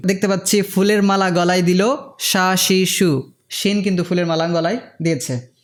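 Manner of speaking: usual speaking voice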